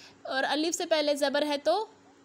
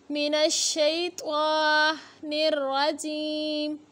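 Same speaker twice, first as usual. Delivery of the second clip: slow, measured chanted recitation; solemn tajweed recitation to open the lesson; Quranic recitation; much slower than usual. Shown as a demonstration. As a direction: recite slowly, drawing each word out. Opening recitation before the lesson.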